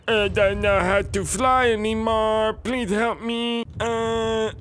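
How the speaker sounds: hoog en smekend, langzaam; panicked plea. Real, not acted. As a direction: smeek wanhopig om hulp. Pleading while dragon flails.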